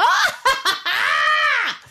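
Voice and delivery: squealing high scream; high shrill cry. Acted. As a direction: let out a piercing squeal. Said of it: "déploie sa voix et pousse un".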